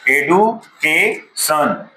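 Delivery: एडुकेशन with a hard retroflex ड, not ज, said slowly; teacher correcting एजुकेशन to एडुकेशन; correct pronunciation. Shown as a demonstration. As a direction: say एडुकेशन slowly with a clear ड sound. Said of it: "एडुकेशन है, एजुकेशन नहीं है".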